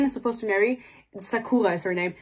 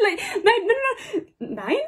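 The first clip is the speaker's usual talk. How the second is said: laughing protest; 11 semitones higher than usual. Real, not acted. Laughing at the plot.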